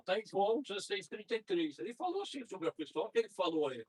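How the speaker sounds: normal talking voice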